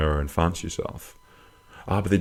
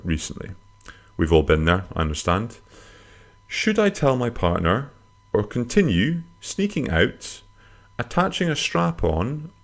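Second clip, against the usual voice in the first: letter read-out; 4 semitones higher than usual. Acted. Host reading a listener's confession to an agony aunt, performed read-out.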